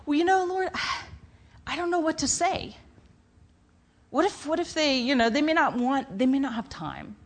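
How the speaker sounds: high, sighing, unsure excuse; hesitant excuse. Retold or imitated. New believer making excuses to God, high and sighing. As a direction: wriggle out of it with a sigh.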